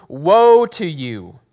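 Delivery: loud, slow, drawn-out woe; proclaiming woe. Acted. Pastor voicing Jesus rebuking the Pharisees, loud and slow. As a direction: call down judgement on them.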